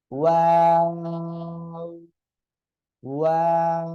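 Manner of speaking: loud ওয়াও, lips rounded at start and end, long held middle; rounded, elongated. Shown as a demonstration. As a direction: intone ওয়াও slowly on a level note. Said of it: "দুই ঠোঁট গোল হবে".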